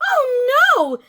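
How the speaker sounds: high loud dismayed cry; yelling. A narrator's reading. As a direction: cry out in alarm. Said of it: "yelled the mother".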